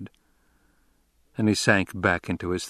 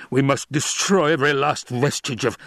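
urgent Baron, raspy and pitched up; rasped urging; 6 semitones higher, 4 dB louder than usual. A narrator's reading. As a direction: rasp out a high, forceful demand. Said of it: "the Baron rasped".

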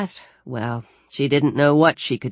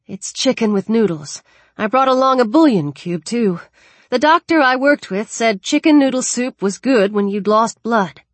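higher-pitched, encouraging caregiver; coaxing girl; 7 semitones higher, 4 dB louder than usual. Acted. Alex offers soup to wounded Daniel, coaxing. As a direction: talk a patient into eating.

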